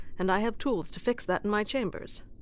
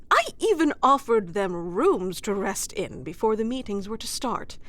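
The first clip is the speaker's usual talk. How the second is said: ordinary talk, nothing marked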